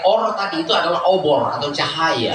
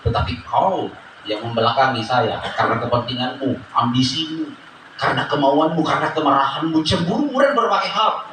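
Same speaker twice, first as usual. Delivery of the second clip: God answering gently; 7 semitones lower than usual. Acted. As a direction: speak as a calm father setting his child straight. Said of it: "Maka Tuhan akan menjawab".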